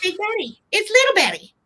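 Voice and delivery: doll voice. Pretend. Seller voicing the little doll to her viewers, high squeaky doll voice. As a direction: chirp it like the tiny doll greeting everyone.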